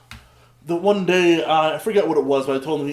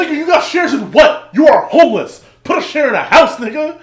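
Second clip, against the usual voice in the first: loud, high incredulous yell; re-enacted indignant shouting; yelled comeback; 8 semitones higher, 9 dB louder than usual. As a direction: yell it back in outraged disbelief. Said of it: "verbatim what you yelled at him".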